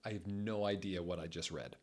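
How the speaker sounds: ordinary delivery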